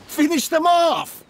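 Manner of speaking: rally the troops with a loud, high yell; shouted battle command. Acted. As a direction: bark the order to finish them, high and loud. Ordering men to attack.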